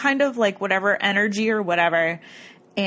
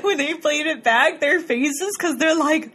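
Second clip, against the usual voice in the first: higher voice, real giggles breaking in; laughing; 7 semitones higher, slower than usual. Real, not acted. Host recalls both their faces, laughing. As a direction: tell it through giggles. Laughing while telling the funny moment.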